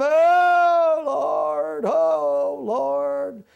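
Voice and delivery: high, loud imitated wail to the Lord; mock wailing. Preacher imitating the youngest sister wailing at the funeral, loud and high. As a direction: wail it out like a grieving sister, hammed up. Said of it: "she would wail and whoop and holler and scream".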